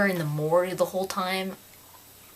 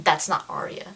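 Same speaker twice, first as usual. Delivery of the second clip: ordinary talk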